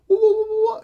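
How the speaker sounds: a high, steady woo-woo; siren imitation. Announcing the cop's return with a mock siren wail. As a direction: mimic a police siren with the voice. Cop car coming back.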